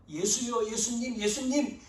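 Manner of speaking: ordinary talk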